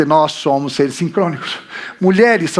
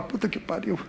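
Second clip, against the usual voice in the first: much softer mock grumble, half laughing; laughing mock complaint under the breath; bored grumbling; 8 dB quieter than usual. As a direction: mutter like a fed-up student. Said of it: "Eu falar".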